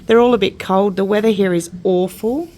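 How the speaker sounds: usual speaking voice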